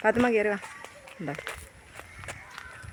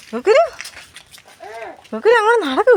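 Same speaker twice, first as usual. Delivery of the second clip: high sing-song call; 12 semitones higher, 5 dB louder than usual. Real, not acted. Mother calling toddler to walk. (കുഞ്ഞിനെ ഉയർന്ന ശബ്ദത്തിൽ വിളിക്കുന്നു)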